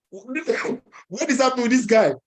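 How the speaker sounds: imitating fed-up beggars; pissed beggars. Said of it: "They were so pissed".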